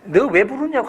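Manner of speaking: high-pitched challenge; lightly acted telling-off, pitched high; acted scolding. Crowd tells the beggar to stop calling, acted scolding. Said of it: "사람들이 부르지 말라고 막".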